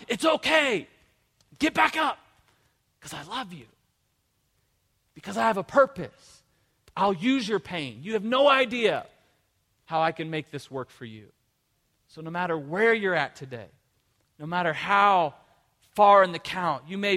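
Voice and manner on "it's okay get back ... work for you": encouraging Jesus. Retold or imitated. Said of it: "Jesus is going".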